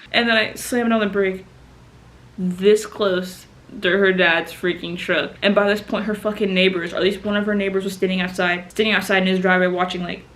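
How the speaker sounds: usual speaking voice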